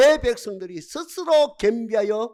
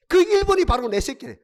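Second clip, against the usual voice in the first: usual speaking voice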